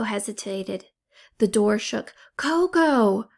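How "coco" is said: higher, louder sharp call of a name; urgent yell. A narrator's reading. Brian yells at Coco to hurry, urgent shout. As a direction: snap her out of hesitating. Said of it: "Brian yelled".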